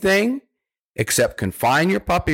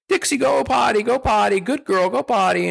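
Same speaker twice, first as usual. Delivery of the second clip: high, coaxing command to puppy; sing-song potty command; 9 semitones higher than usual. Shown as a demonstration. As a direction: sweetly urge the puppy to go. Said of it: "when you say".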